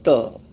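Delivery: त said as a bare short t; teacher sounding out त alone; letter sound demo. Shown as a demonstration. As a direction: clip त to a crisp short t. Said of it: "उसका तलफ़ुज़ है".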